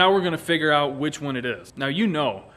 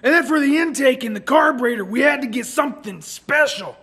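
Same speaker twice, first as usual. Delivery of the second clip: normal talking voice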